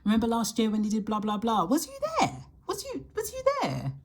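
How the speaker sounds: fast, climbing into a squeaky high voice; mock eager questioner. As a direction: push someone to remember, pitch shooting way up. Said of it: "someone trying to recall back a scenario".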